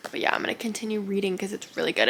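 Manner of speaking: usual speaking voice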